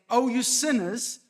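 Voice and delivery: scolding call to sinners; rebuking address. Shown as a demonstration. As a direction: call them out sharply. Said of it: "rebuke versus a loving address".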